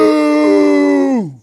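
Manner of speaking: long, loud, high boo; heckler's boo. Retold or imitated. Heckler back at another club, loud sustained high boo. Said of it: "it's her again".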